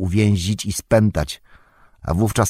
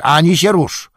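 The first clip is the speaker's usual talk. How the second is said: much higher, louder repeated warning; louder shout; 9 semitones higher, 6 dB louder than usual. A narrator's reading. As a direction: yell the order in full force. Soldier repeats the threat louder.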